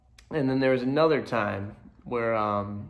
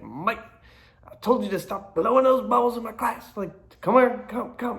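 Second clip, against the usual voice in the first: high, loud mock-teacher telling-off; raised-pitch mock scolding; 11 semitones higher, 4 dB louder than usual. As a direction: imitate an exasperated teacher calling out a pupil. Teacher scolds him in retold scene.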